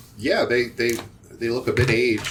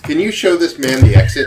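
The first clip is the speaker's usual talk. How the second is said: raised, loud character voice; merchant giving orders; 6 semitones higher, 10 dB louder than usual. Acted. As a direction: order the henchman, loud and high. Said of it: "he says to Periwimple".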